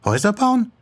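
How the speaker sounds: "Häuser bauen" as a high, surprised question; incredulous echo. Acted. Yoko questions her friend's plan, "Häuser bauen" in high disbelief.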